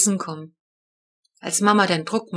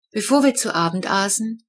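ordinary delivery, nothing marked

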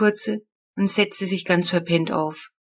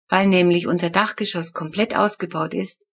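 ordinary delivery

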